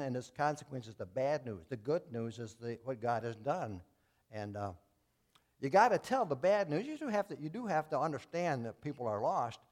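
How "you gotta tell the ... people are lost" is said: preacher building to a climax; full-force preaching. Acted. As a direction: drive home the point from the pulpit.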